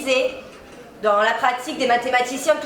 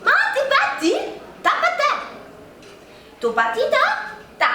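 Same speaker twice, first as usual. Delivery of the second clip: much higher alien babble in nonsense syllables; martian gibberish; 11 semitones higher than usual. Pretend. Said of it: "tu parles en martien". (charabia martien aigu)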